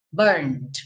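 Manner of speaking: "burned" in one syllable, "burnd", no E sounded; "burned" ending in a plain "nd", without a vowel; silent E. Shown as a demonstration. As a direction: run the N straight into the D in "burned". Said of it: "o E não é pronunciado".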